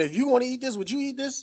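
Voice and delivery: lightly mimicked sweet question; mock reporter voice. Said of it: "She all asking my man".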